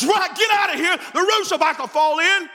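a friend bursting in, shouting to run; panicked warning. Retold or imitated. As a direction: yell it like the roof is coming down.

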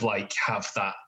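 normal talking voice

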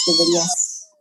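very high squeaky echo. Pretend. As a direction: squeak it out playfully.

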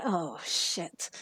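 a soft "Oh, shit." through a laugh; mock quiet curse. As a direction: mutter it as if caught out. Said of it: "I went".

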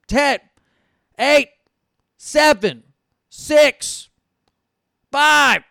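shouting a countdown at a friend who won't answer; loud countdown. Real, not acted. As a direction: hurry him up, yelling each number. Pressuring Steven with countdown.

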